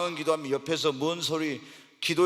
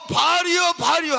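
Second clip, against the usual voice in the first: loud, high mock cry of Baal's prophets; 13 semitones higher, 7 dB louder than usual. Baal's prophets shouting for their god, loud mock cry. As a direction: call out to a god who won't answer.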